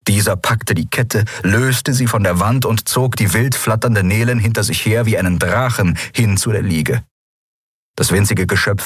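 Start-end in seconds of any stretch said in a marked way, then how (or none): none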